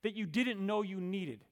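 high, ringing scripture proclamation; emphatic preaching. Acted. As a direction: proclaim it forcefully to a congregation. Preaching and reading scripture aloud.

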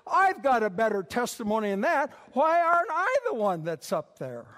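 indignant protest. Acted. Preacher mimics his jealous self, indignant protest.

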